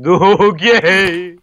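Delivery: high loud dim-witted okay; dopey okay. Retold or imitated. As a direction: answer like a dazed engine. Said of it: "He's like".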